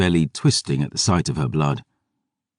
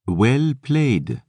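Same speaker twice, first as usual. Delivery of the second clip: French vampire voice; 7 semitones higher than usual. Acted. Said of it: "Jean-Francois shrugged".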